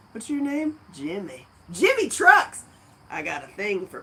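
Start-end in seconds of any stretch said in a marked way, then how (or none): 1.7-2.6 much higher, louder exclaim; excited exclaim. Real, not acted. Greeting viewer Jimmy Trucks with excited high exclaim. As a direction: burst out with the name.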